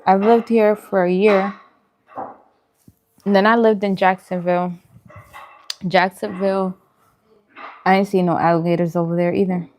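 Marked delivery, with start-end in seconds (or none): none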